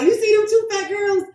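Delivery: high, loud mock-excited question; mimicking husband. Wife imitating husband's conversation opener, mock excited.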